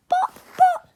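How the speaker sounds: high-pitched, loud stammered protest; flustered little-boy protest; spluttering. A narrator's reading. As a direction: splutter in squeaky dismay. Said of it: "spluttered Perfect Peter".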